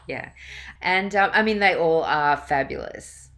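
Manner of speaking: ordinary talk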